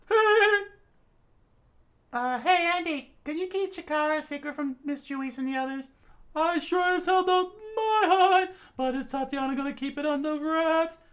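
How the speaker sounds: high, loud calls; high voices. Acted. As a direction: ask to keep a secret. Characters high.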